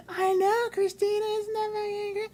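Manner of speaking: very high squawking mock phone voice. Friend turning away mid-call to someone else, very high mock chatter. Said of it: "then she would turn her".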